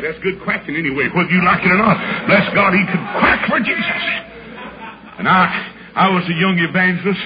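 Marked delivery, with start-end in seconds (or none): none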